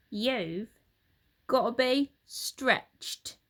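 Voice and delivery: slow, drawn-out sentence; Mr Twit, slow and sinister. Acted. Husband tells his wife her cure, slow and sinister. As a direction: let it land ominously.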